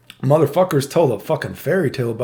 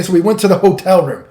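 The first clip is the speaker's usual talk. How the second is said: normal talking voice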